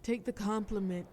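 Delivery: ordinary talk, nothing marked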